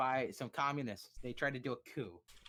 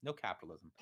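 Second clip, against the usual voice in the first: gruff refusal; 7 semitones lower, 4 dB quieter than usual. Retold or imitated. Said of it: "is like".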